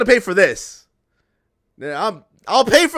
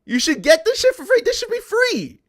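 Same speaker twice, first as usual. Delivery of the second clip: much higher, squeaky indignant repeat; mock outraged squeal; 11 semitones higher than usual. Repeating the complaint, pitch leaping up.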